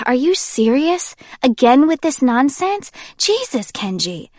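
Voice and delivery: high, exasperated sigh of a question; fed up with his nonsense; irritated. Acted. Jay, irritated with Kenji's drama, high exasperated questions.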